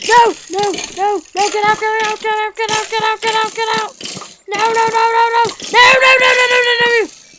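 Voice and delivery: loud high frantic yells; panicked screaming. Pretend. Gamer being attacked in the cave, frantic screaming. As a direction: scream it as the mob closes in.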